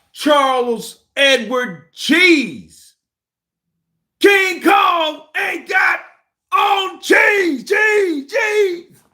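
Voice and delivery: booming drawn-out announcement climbing to a high holler; hammed-up shouting. Streamer hyping the chat, hammed-up high shout. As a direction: holler it high and loud, stretching the words.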